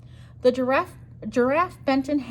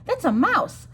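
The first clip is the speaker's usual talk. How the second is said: higher, louder panicked cry; alarmed cry; 9 semitones higher, 5 dB louder than usual. Acted. Elephant panicking at the mouse, alarmed cry. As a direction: sound the alarm.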